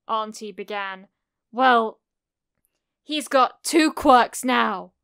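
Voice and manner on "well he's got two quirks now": put-on loud, slow, resentful child; angry snarl. A narrator's reading. Kacchan snarls before stomping out, loud and slow. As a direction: snarl it out loudly, word by word. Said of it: "Kacchan snarled".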